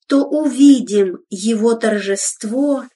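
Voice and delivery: normal talking voice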